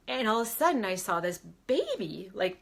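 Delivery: ordinary delivery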